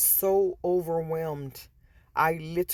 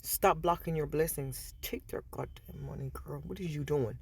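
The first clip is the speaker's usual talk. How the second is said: voice of Spirit; 8 dB quieter than usual. Retold or imitated. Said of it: "Spirit said".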